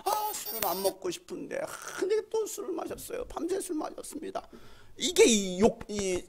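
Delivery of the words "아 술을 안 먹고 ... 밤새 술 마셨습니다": put-on soft, plaintive drunkard's complaint; mock woeful confession, pitch climbing; imitated lament. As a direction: moan softly like a caller pouring out troubles. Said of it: "막 하소연을 하면서".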